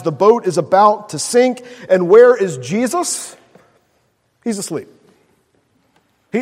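ordinary delivery

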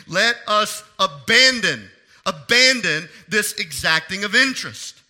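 "abandon" punched loud and drawn out; stress on ABANDON. Real, not acted. Preacher reading verse ten to church, heavy stress on "abandon". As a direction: hit "abandon" hard each time. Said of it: "I emphasize the word abandon".

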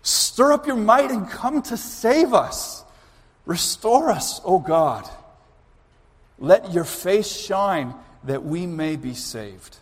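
solemn scripture reading. Acted. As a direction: proclaim the verses slowly and solemnly. Reads the psalm aloud.

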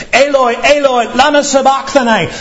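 high, far-reaching cry of agony; loud cry. Retold or imitated. Preacher voicing Jesus crying out from the cross, high anguished cry. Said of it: "he cried in a loud voice".

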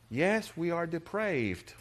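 ordinary talk

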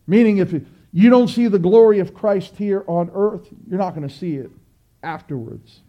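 normal talking voice

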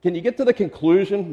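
a light tease of bored listeners; impatient listeners. Retold or imitated. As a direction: play the restless crowd begging you to wrap it up. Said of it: "Is it the people saying".

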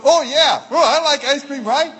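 playful excited outburst; imitated excitement. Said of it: "your brother went".

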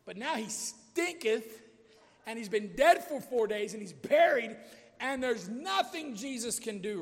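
loud, dramatic mock doubter; limited faith. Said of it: "Our faith has limits".

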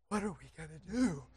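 scared hushed question. Retold or imitated. Scared whisper at the ram.

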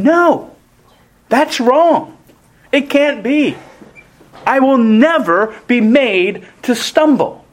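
Peter flatly refusing, rising up; voiced Peter brushing Jesus off; defiant Peter.

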